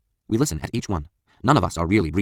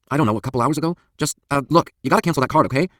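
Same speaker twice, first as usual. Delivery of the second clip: raised, urgent pleading on the phone; frantic pleading; 8 semitones higher, 5 dB louder than usual. A narrator's reading. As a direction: press the clerk anxiously to act. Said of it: "my frantic pleas".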